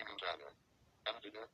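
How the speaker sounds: "Nevada" garbled like "netadda"; mispronounced. Shown as a demonstration. Failing to name a bordering state, mispronounced "Nevada". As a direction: muddle the middle consonant of "Nevada". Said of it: "cannot even pronounce the name".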